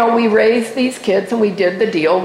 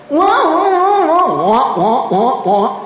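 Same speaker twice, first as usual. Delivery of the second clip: mock crybaby wah; 8 semitones higher, slower than usual. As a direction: taunt him with a fake crybaby whine. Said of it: "and said".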